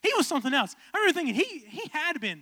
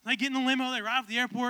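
ordinary talk, nothing marked

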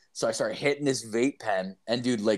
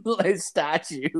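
laughing; 6 semitones higher, slower than usual. Real, not acted.

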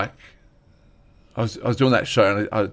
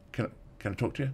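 hushed request; 14 dB quieter, much faster than usual. Retold or imitated. Dude approaching him after a show.

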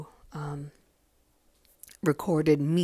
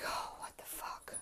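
breathy whispered oh what the fuck; whispered disbelief; 17 dB quieter than usual. Retold or imitated. Remembering the bad German translation, whispered disbelief.

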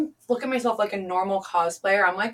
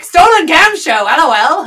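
higher and louder hype; mock clickbait; 9 semitones higher, 14 dB louder than usual. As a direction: sell it like a headline. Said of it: "They're like".

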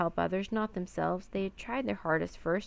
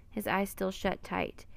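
plain reading voice

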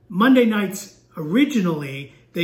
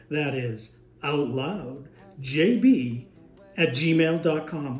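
slow, deep, drawn-out announcer voice; fighting game announcer; 4 semitones lower, much slower than usual. Shown as a demonstration. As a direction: stretch every syllable like a big arena announcer. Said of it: "we'll go into announcer voice".

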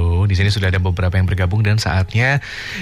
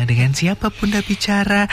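put-on childlike voice; 13 semitones higher than usual. Mimics kids' show caller.

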